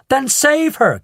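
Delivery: high, loud frantic plea; crying out. A narrator's reading. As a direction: beg him to act. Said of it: "cried Missus Salt".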